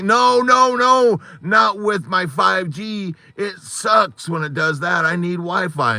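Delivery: high, insistent re-enacted objection; serious protest, retold. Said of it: "and I was serious".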